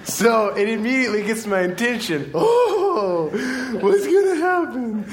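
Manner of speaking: high, loud, laughing through the words; can barely get it out for laughing. Real, not acted. Pastor cracking up at Jesus spitting, laughing. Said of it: "loogie on their face".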